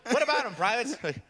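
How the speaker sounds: high, brusque military voices; acted barracks exchange. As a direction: demand and report like soldiers. Acted sergeant and private exchange.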